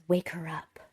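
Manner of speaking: hushed urgent whisper. Retold or imitated. Husband whispering to wife, urgent whisper. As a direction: whisper the order. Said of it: "kinda whispers it".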